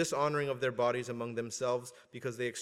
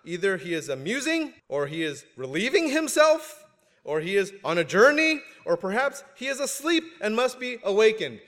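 louder, high mocking taunt; mocking Elijah; 7 semitones higher, 7 dB louder than usual. A narrator's reading. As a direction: taunt them with sarcasm. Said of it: "Elijah mocked them".

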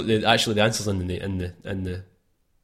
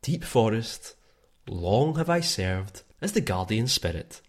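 softer, slow storyteller narration; bedtime story read; 3 semitones lower, 4 dB quieter, slower than usual. Acted. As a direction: tell it gently like a forest tale. Reads Deku tree intro.

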